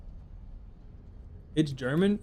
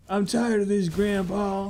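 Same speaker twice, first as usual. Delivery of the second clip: meme voice; 6 semitones higher, slower than usual. Pretend. Meme quote, game character.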